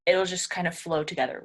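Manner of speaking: usual speaking voice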